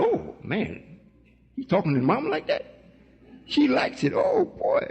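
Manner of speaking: hushed, wondering mock child; child's voice. Child overhearing father talk to mom, quietly amazed put-on voice. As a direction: imitate a child marvelling, softly, rising high. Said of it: "The child said".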